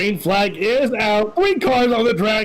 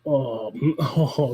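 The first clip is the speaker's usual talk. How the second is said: laughing through the words; 6 semitones lower, 4 dB quieter than usual. Real, not acted. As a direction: chuckle it out, lower. Laughing at a crash replay.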